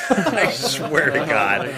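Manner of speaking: ordinary talk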